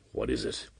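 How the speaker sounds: low, soft hoarse question. Acted. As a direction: rasp it out hoarsely. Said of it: "he asked hoarsely".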